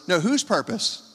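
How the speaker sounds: normal talking voice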